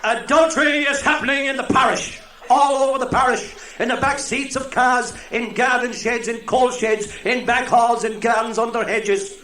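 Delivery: loud, high fire and brimstone; preaching missioner. Retold or imitated. Missioner thundering at the congregation about adultery. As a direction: bang the pulpit and thunder. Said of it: "the missioner was saying".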